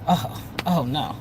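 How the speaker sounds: low, slow, soft warning; ominous oh no. Retold or imitated. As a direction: let it sink in slowly. Shocked reaction to back talk.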